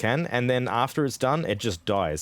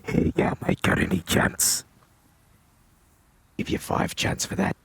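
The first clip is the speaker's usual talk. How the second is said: put-on broad Aussie bogan accent, hushed; 9 semitones higher than usual. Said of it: "in the Bogan voice?".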